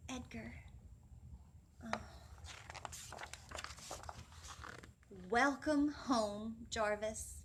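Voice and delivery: put-on animated children's story voice; storytime reading. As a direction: read it to the class with big expression. Reads picture book aloud.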